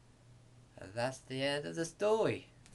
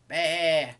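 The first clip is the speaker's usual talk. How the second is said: loud, higher bleat; sheep's bleat; 4 semitones higher, 9 dB louder than usual. A narrator's reading. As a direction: bleat out loudly. Sheep chases farmer away.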